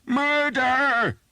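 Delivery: very high, very loud scream; screaming alarm. Acted. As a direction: scream it out in desperation. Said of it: "he screamed".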